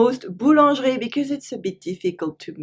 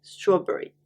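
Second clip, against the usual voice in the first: ordinary delivery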